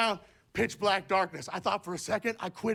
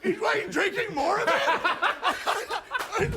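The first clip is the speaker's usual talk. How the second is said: ordinary talk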